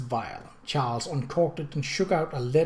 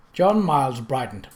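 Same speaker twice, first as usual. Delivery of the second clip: ordinary delivery, nothing marked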